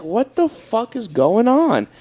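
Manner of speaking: confused alarm. Retold or imitated. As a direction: spell out the confusion slowly. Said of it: "I was like".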